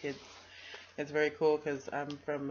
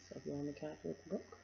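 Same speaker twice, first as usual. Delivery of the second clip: plain reading voice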